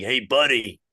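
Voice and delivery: put-on slow, raspy Brooklyn accent; raspy Brooklyn old-timer. Brooklyn old-timer telling someone off his car, raspy Brooklyn accent. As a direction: rasp it out in thick Brooklyn. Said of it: "really raspy voices".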